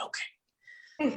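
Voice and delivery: breathy unvoiced okay; whispering. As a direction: whisper it back, coyly. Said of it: "So I said".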